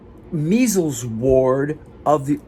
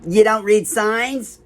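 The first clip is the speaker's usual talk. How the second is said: high, slow, incredulous imitation; scornful guard; 8 semitones higher, 3 dB louder, slower than usual. Guard scolds visitor asking for Kaufman, high scornful imitation. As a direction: stretch it out high as a put-on rebuke. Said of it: "And she goes".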